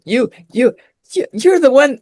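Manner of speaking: excited stranger pointing. Retold or imitated. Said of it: "he was really excited".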